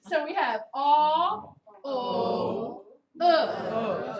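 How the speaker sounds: aw, o, uh each pulled slow and loud; teacher demonstrating the Baltimore vowel slide; Baltimore diphthong demo. Shown as a demonstration. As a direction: stretch each vowel to show the glide. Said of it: "This is what you hear".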